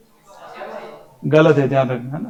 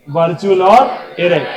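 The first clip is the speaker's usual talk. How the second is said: louder, slow, spelling out the answer; 8 dB louder than usual. Shown as a demonstration. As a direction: say it loud so it sticks. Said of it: "क्या कहलाती है?". (ज़ोर से धीरे-धीरे उत्तर)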